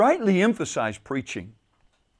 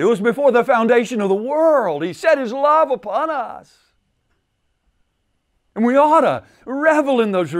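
much higher, impassioned preaching; rising fervour; 6 semitones higher than usual. Real, not acted. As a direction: lift the voice in fervent praise. Preacher builds to a passionate peak.

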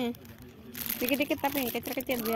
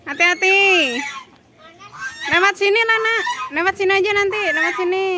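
calling out; 8 semitones higher, 9 dB louder than usual. Real, not acted. Parent warning children to take care, calling out. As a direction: raise your voice to steer them this way.

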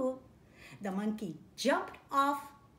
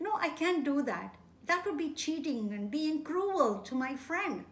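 firm refusal; 4 semitones higher, 5 dB louder than usual. Acted. Crocodile refusing his wife.

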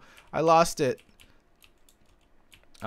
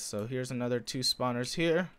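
ordinary talk, nothing marked

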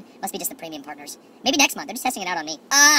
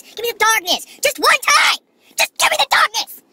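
high, loud, drawn-out dark plea; mock-epic high cry; dramatic plea; 13 semitones higher, 7 dB louder, slower than usual. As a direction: beg for the darkness like a villain.

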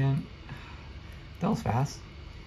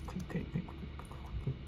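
urgent whisper; 13 dB quieter than usual. Real, not acted. Urgent sneaking in game.

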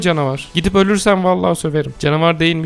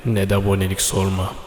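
mock ancient proverb; 9 semitones lower, 5 dB quieter than usual. Reciting a fake proverb to viewers in a lower sage voice. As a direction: intone it like a wise old master. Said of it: "Çin atasözü".

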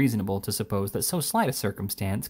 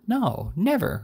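higher-pitched, astonished denial; 6 semitones higher, slower than usual. A narrator's reading. Pickwick answering the lieutenant, astonished. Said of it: "replied the astonished Mister Pickwick".

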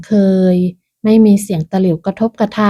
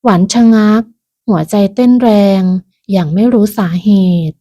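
plain reading voice

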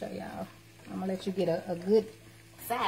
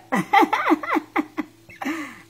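laugh; 10 semitones higher, 9 dB louder than usual. Real, not acted. Laughs showing the plate.

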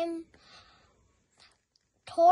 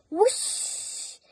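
whoosh; 3 semitones higher than usual. A narrator's reading. Sound effect of hare speeding.

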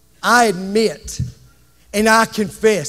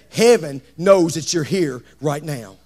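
ordinary talk, nothing marked

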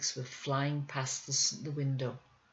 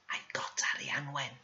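whisper; 4 dB quieter than usual. Acted. Boy confides secret to girl.

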